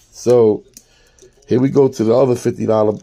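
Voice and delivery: usual speaking voice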